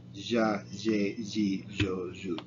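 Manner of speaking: regional ll sounded as zh; zh variant. Shown as a demonstration. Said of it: "it's also kind of zh".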